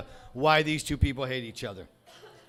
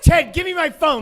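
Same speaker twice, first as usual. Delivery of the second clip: higher and louder call across the room; 10 semitones higher, 5 dB louder than usual. Real, not acted. Comic calls to Ted for his phone, loud call. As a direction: shout for the phone.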